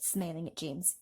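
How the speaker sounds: ordinary delivery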